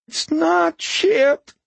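very high, slow whine; whining homeless man. A narrator's reading. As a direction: draw it out in a wounded whine. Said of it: "the man whined".

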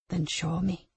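low, soft sinister command; menacing quiet voice. Acted. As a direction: order it quietly. Abuser commands.

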